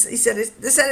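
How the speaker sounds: normal talking voice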